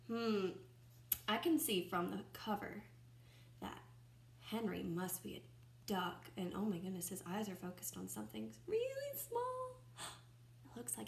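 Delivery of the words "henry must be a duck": ordinary delivery, nothing marked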